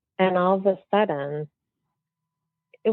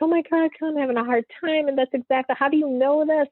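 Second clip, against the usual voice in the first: much higher, imitating a tearful friend; retold bawling; 9 semitones higher than usual. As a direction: voice the sobbing friend, pitched way up. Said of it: "she just starts bawling".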